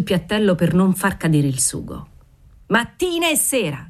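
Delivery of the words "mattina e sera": high-pitched call without real volume; mock shriek. A narrator's reading. Waiter calling from the threshold, mild shriek. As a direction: call it gaily from the doorway, voice raised in pitch. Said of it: "strillò dalla soglia il garzone".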